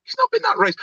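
squeaky high, amused group voice; a high jokey group chorus; mock high voice. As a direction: pitch way up in mock disbelief.

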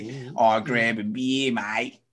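broad Aussie drawl on grab a beer, mate; playing the Sydney bar regular in a harsh Sydney accent. Retelling an Aussie bar customer, broad harsh Sydney accent. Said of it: "That was really far".

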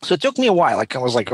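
ordinary talk, nothing marked

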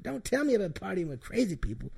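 Diamond's voice. Retold or imitated. Imitating Diamond in the tent, high put-on voice. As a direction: say it up high as her. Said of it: "She said".

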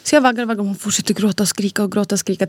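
normal talking voice

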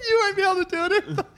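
high squealing laugh; laughing so hard it hurts; hysterical laughter. Real, not acted. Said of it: "hardest I've ever laughed".

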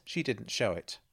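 usual speaking voice

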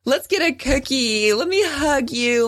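put-on baby her with a sweet high tone; coddling mom. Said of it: "She wants me to be like".

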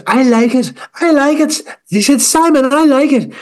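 high, gushing judge voice; mock Louis Walsh. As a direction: gush at the wallpaper like a star judge.

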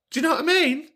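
very high squeaked appeal; high-pitched indignant check-in; comic indignation. Acted. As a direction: squeak it, seeking agreement. Indignant on caller's behalf.

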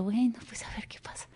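breathy whispered aside to herself; hushed inner voice. Retold or imitated. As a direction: whisper it like a private thought.

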